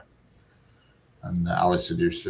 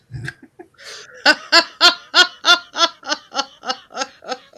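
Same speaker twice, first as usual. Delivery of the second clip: high loud laugh; cackling laugh; 9 dB louder than usual. Real, not acted. Man cackling after admitting he spies on the neighbour. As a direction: cackle at your own joke. Laughs at own pervert joke.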